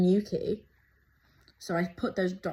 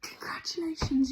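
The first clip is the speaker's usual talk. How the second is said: 1 reading it out excited; 8 semitones higher than usual. Retold or imitated. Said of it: "And then it goes".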